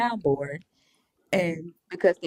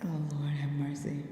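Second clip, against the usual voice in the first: whisper; 3 semitones lower, 10 dB quieter than usual. Real, not acted. Hushed aside.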